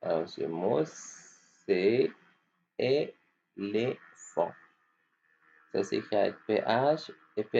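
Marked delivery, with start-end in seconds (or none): 2.8-4.5 éléphant drawn out slowly, é-lé-phant; split into syllables. Shown as a demonstration. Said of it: "découper". (« éléphant » découpé lentement en syllabes)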